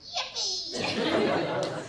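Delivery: sudden high-pitched cheer; tic outburst. Acted. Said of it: "Uh, Tourette's".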